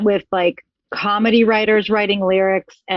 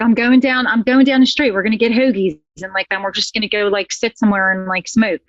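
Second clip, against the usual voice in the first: demonstrated Philadelphia accent; casual, thick-voweled Philly accent. Shown as a demonstration. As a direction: talk like a Philly local, vowels rounded. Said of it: "Can you do a Philly?".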